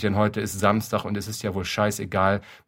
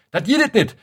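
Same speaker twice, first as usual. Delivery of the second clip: shrill Kölsch shriek, much higher and louder; 13 semitones higher, 8 dB louder than usual. Acted. Neighbour woman at the prams, shrieking in Kölsch. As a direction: screech it in outrage.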